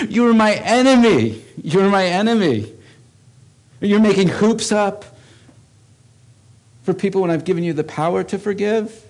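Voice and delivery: high, loud voice of God confronting; God's voice. Acted. God rebuking his people for making hoops, loud and high. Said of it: "he goes".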